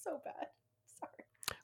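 whispered embarrassed apology. Real, not acted. Whispering sorry to the co-hosts after laughing. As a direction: drop to a whisper, sheepish.

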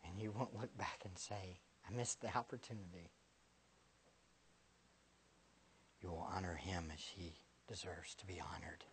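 soft, low whispered reverence, mostly unvoiced; hushed devotional. Preacher whispering to the congregation about eternity. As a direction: breathe it out like a quiet prayer. Hushed closing of a prayer-like passage.

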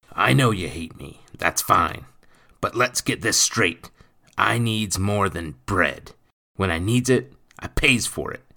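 loud, bullying lecture, pitch climbing; stern rough-spoken stepfather. A narrator's reading. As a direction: lay down the law to the boy.

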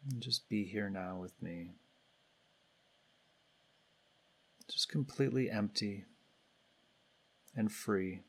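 calm, unhurried guidance with long pauses between phrases; guided meditation. Real, not acted. Meditation leader guiding everybody to turn attention inward, calm and spacious. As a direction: lead the group gently inward, leaving space after each phrase.

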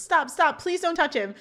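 high, mildly frantic plea; acted urgent plea. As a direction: act out rushing in to stop someone. Reads Topanga rushing in to intervene.

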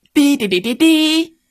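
high horn toots; hard-blown toy horn. A narrator's reading. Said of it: "开始使劲儿地吹".

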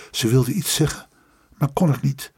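ordinary delivery

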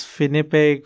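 Finipeg in three syllables, "FIN-eh-peg"; three-syllable pronunciation. Shown as a demonstration. Guest trying out the company name, Finipeg as "fin-eh-peg". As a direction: add a middle vowel in Finipeg. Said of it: "I don't know the pronunciation".

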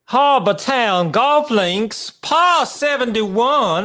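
Harbortown Golf Links said high and slow in a Southern drawl; mock South Carolina drawl on par seventy-one; South Carolina accent. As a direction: redo the course name in a hammed-up South Carolina accent. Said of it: "Do you have a South Carolina accent for me?".

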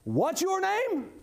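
high, slow insistent ask. Retold or imitated. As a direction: push the question up and out slowly. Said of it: "repeated the question".

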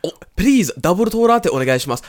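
loud, high mock Japanese accent; hammed-up Japanese-accented pleading order; caricature Japanese accent. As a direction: push the katakana accent hard and loud. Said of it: "saying it in a racist accent".

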